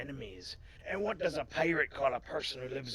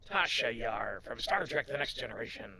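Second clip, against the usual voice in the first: ordinary talk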